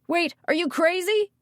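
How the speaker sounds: high, loud, incredulous cry; alarmed shout. A narrator's reading. Eric tries to stop the mayor going in, shouted. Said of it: "Eric shouted".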